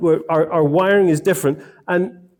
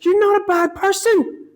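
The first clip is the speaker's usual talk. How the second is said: very high, hammed-up gentle reproach; husband sighing at his wife over the car, hammed-up gentle reproach; mock gentle scolding; slower than usual. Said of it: "I was like".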